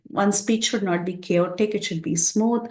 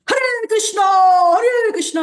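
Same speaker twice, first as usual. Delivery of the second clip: high, loud, drawn-out chant-like shout; demonstrated shout; 13 semitones higher, 8 dB louder, slower than usual. Shown as a demonstration. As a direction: show how not to speak by shouting at full volume. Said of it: "like that, one should not shout".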